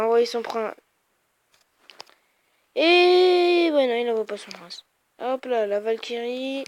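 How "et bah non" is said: surprised. Real, not acted. Surprised the enemy holds back.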